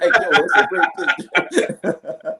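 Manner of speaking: high, slow, laughing mock praise; fake-congratulate him while cracking up; mock praise through laughter. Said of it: "Shoot him like".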